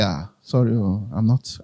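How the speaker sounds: low, soft reenacted refusal; subdued apology. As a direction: back out gently, voice dropped low and soft. Acting out turning down the offer.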